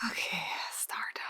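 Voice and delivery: hushed whisper; whispering while drawing stars. Real, not acted. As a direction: whisper to yourself while working. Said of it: "unvoiced whisper".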